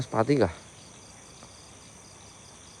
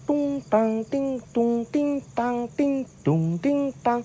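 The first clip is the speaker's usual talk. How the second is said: slow high soft tung-ting chime; slow sing-song mock gamelan, higher and softer; gamelan imitation; 11 semitones higher, 3 dB quieter, much slower than usual. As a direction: hum it out like gamelan gongs. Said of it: "gamelan kayak gitu".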